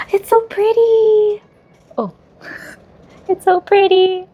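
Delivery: high squealing delight. Real, not acted. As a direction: swoon over how pretty it is. Admiring the light stick.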